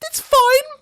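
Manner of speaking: hammed-up cheery high voice; mock oblivious. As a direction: play dumb, way too breezy. Mock-playing oblivious officials.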